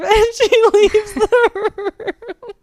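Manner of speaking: high, loud, drawn-out through laughter; laughing. Real, not acted. Reader retelling Tatum walking out on a girl, laughing. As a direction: crack up laughing as you tell it. Laughing at retold abrupt exit.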